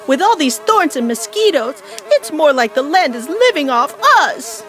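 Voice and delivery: high-pitched exasperated mama bear; indignant shout. Acted. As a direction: complain at high pitch while fleeing, punching key words. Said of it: "shouted Mama as they ran".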